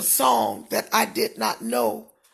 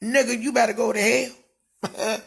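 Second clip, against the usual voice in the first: normal talking voice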